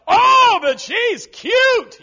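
high, slow swooning shout; mock smitten young man. Preacher mocks young man's excuse, swooning shout. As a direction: gush over her like a lovestruck fool.

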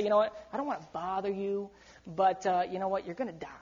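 soft, hesitant, dropping away to near nothing; timid apologetic warning. Retold or imitated. Watchman timidly warning a neighbour, soft and hesitant. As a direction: tiptoe around the bad news, apologising. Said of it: "he didn't come up to somebody and say".

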